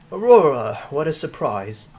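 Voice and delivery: a Cruella-style British stepmother, not quite landed. Acted. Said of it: "I don't know why I made her British".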